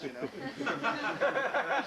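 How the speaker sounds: ordinary talk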